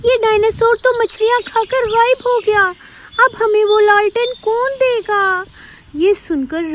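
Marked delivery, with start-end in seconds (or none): 0.1-5.4 high, startled, worried; dismayed surprise. Acted. Rapunzel dismayed the dinosaur vanished, high and startled. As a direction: gasp at the loss.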